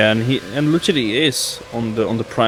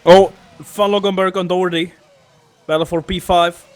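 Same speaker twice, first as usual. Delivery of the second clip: higher and louder call; excited race call; 6 semitones higher, 5 dB louder than usual. Real, not acted. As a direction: call the overtake live. Overtake call.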